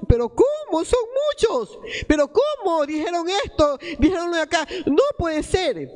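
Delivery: high, pleading disbelief, mock alarm; disbelieving protest. Preacher mimics believers' panicked disbelief when God tests them. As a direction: act out the shock of being tested by God. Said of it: "Ahí enseguida decimos".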